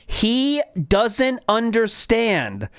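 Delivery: very drawn-out, deliberate delivery; slow, pointed quoting. Retold or imitated. Host repeats what he literally said, slow pointed quoting. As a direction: spell it out slowly, word by word. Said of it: "a very special way to say that".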